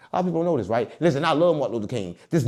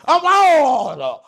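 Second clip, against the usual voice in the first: mock alarm; 11 semitones higher, 7 dB louder, much slower than usual. Retold reaction to police question.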